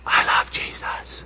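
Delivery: hushed whisper; quiet voice. Retold or imitated. Grandson Joey switching on his quiet voice, whispered. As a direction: whisper it like a little boy using his indoor voice. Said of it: "Turn on your quiet voice".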